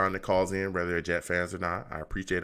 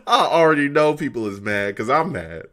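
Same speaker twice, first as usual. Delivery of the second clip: loud rant; 7 semitones higher, 8 dB louder than usual. Real, not acted. Ranting about the team, laughing.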